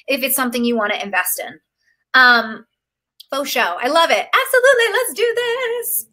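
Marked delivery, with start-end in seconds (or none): 4.3-6.1 high, bright burst of enthusiasm; excited cheer. Real, not acted. Coach cheering a client's decision, excited high cheer. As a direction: cheer her on like a hype coach.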